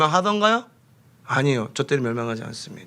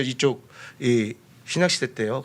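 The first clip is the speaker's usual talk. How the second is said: usual speaking voice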